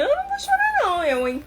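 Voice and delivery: put-on high, fast defiant little girl voice; defiant child. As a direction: play the stubborn kid refusing to cry. Child refusing to cry.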